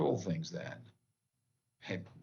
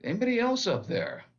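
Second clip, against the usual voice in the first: much higher, loud hopeful call; punchline plea; 9 semitones higher, 4 dB louder, faster than usual. Retold or imitated. Man rejecting God's answer, calling out high and loud. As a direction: shout up, hoping for someone else. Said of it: "And the guy says".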